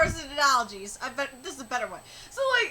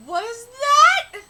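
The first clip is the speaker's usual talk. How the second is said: high, rising, loud disbelief; shocked; 6 semitones higher, 9 dB louder than usual. Real, not acted. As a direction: blurt it out aghast. Shocked by the line read.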